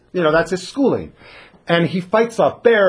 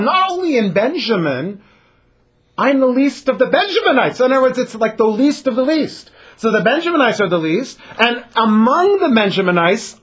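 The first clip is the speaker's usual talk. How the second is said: Saul protesting humbly; 7 semitones higher than usual. Retold or imitated. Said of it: "and he says".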